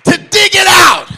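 loud, high-pitched shouted preaching; preaching at full force. Acted. Preacher shouting to the congregation, full force. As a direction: hammer it home to the congregation.

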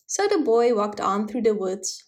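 plain reading voice

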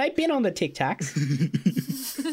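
put-on high, slow old-lady voice; savvy grandma voice. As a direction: play a proud, hip grandma.

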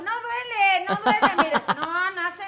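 high, loud, laughing "it doesn't hurt"; laughing reassurance. Retold or imitated. Reassuring the shoppers a rat bite doesn't hurt, high and laughing. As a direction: brush it off while laughing. Calming people while bitten.

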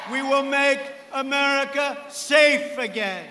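loud, building rally chant; rally speech. Real, not acted. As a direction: drive each line harder to the end.

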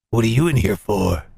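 very low, menacing question; deep, intimidating inmate; hardened killer. Acted. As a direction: ask back in a deep convict voice. Killer asks the cellmate back.

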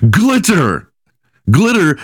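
high, disbelieving cry of the word; incredulous shout. Real, not acted. Comic host disbelieving a glitter spell, incredulous cry.

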